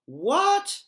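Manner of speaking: sudden high startled what; surprised. Acted. Surprised reply on phone call.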